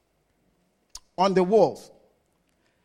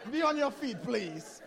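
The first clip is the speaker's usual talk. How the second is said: laughing through the request; 6 dB quieter than usual. Real, not acted. Pastor laughing as he asks a guest to stand. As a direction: chuckle your way through it, friendly. Laughing while inviting people up.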